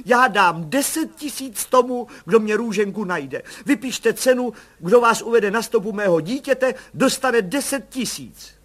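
high, eager, slowed pleading; mother blurting a reward offer to the commissioner; blurting hopefully. A narrator's reading. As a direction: blurt out the offer in sudden hope. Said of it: "vyhrkla náhle v záblesku naděje".

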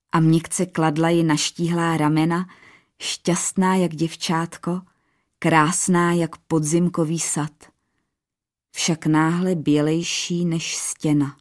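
plain reading voice

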